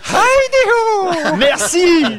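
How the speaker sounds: very high, slow cartoon cheer, stretched out; Monsieur Anki voice. Shown as a demonstration. Voice actor does his cartoon character for the host, very high and drawn out. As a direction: sing out the greeting like a tiny cheerful cartoon character. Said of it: "je veux que tu le dises".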